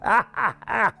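high staged "ha, ha, ha"; excited scornful cackle; scoffing laugh. Acted. Excited Australian pigeon scoffing.

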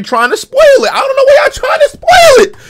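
high-pitched yell of real exasperation; exasperated shout. Real, not acted. Streamer to chat spoiling the video, exasperated high shout.

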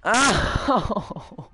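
high, loud falling howl; frustrated wail. Real, not acted. Player after losing the round, frustrated wail.

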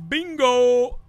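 high, loud, held callout; catchphrase shout. Acted. Player calling out the line from the game, loud. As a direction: sing out the catchphrase loudly.

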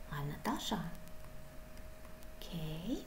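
whispered to herself; soft whispered aside. Real, not acted. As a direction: breathe it out in a whisper.

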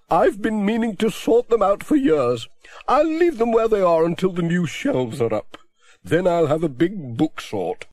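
a chatty spinster complaining cheerfully; voiced Miss Hubbard.

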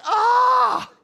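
loud, high groan at a painful price; acted-out wail at the cost. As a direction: cry out as if the bill hurts. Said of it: "it's painful".

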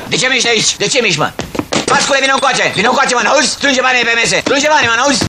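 high, hard commands over the room; bully them into line; raid shout. Acted. Police raid on gamblers.

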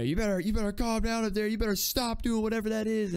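high, loud imitation of a dad yelling from downstairs. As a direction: holler up the stairs like a fed-up parent. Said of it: "like, yelling from downstairs".

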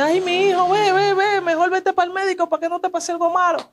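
high-pitched pleading urging; high, urgent imitation of an older woman; worried neighbourhood lady. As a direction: voice a doña fussing over a hurt boy. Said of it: "una doña me dice".

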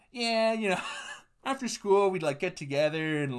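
high, casual bro voice dropping a bombshell; offhand high-pitched bro confession; weird guy impression. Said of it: "this really weird guy".

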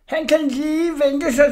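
high, slow, seething hiss with stress on sig; hissing threat. Acted. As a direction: spit out the revenge vow. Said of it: "hvordan han hvæsede".

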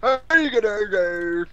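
loud, high farmer calling out. Pretend. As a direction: shout like a farmer herding. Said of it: "farmer's voice".

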